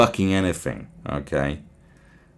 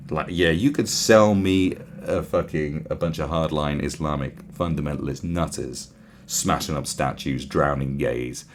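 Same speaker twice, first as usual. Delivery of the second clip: ordinary talk, nothing marked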